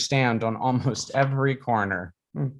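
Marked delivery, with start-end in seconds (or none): none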